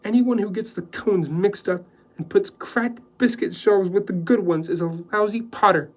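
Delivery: Joy cutting the houseboy down curtly. A narrator's reading. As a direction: dismiss him sharply. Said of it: "she said curtly".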